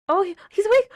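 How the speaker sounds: high, loud shout of disbelief; excited shout. Real, not acted. Watching the character wake up, shouting high and loud. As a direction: yell it out in shock.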